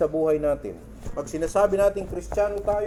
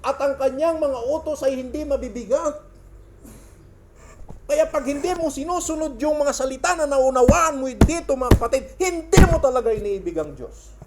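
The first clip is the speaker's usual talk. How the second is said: loud, raised-pitch exhortation; impassioned preaching; 8 semitones higher, 6 dB louder than usual. Real, not acted. Preacher exhorting the congregation to obey God's word, impassioned. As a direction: preach it out forcefully, driving each phrase.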